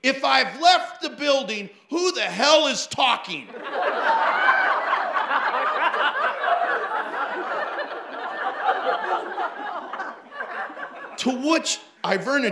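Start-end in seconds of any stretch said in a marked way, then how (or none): 0.0-3.5 loud, high blurted shout; retold loud heckle; louder voice. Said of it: "in a louder voice proclaimed this". 3.5-11.1 long high cackling laugh; real burst of laughter; big laugh. Real, not acted. Audience roar after punchline.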